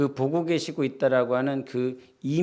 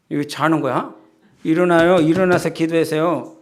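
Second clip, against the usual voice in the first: loud, higher nag at a dozing worshipper; nagging wake-up call; 3 semitones higher, 8 dB louder than usual. Retold or imitated. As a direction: bark at them to get up and pray. Pastor nagging a sleeping prayer. (졸고 있는 신자를 다그치는 목사, 큰 소리로 깨우듯)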